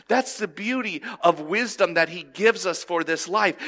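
normal talking voice

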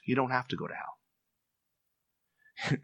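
soft, slow comfort after the warning; reassuring hush. Real, not acted. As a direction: ease into it gently and slowly. Good news after warning of hell.

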